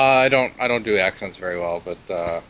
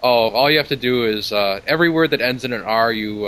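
ordinary delivery

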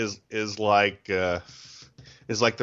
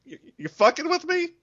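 incredulous retort. Retold or imitated. Exasperated retort in retold quote.

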